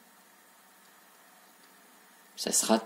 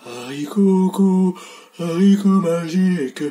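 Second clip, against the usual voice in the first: ghost voice; 5 semitones higher than usual. Shown as a demonstration. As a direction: moan it like a ghost. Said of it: "Une voix de fantôme".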